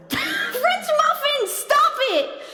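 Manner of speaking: high, laughing squeal of protest; drawn-out high scolding through laughter; playful scolding yell. Real, not acted. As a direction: shriek it in mock exasperation. Playfully scolding a gifting viewer.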